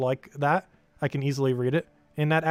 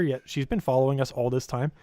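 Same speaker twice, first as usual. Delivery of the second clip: usual speaking voice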